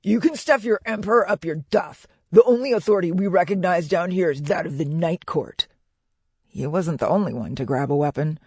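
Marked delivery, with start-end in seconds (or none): 0.0-5.7 defiant merchant voice, pitched up; raised, indignant character cry. A narrator's reading. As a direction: shout them down in rough defiance.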